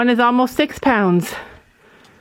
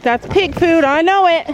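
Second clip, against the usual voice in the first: higher-pitched eager animal talking; animal's imagined voice; 7 semitones higher than usual. Pretend. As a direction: voice the excited animal's thought, playfully. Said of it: "He's like".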